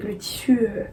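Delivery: whispered to herself while searching. Real, not acted. Vlogger whispering to herself while hunting for the fabric name. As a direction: whisper it under her breath while searching.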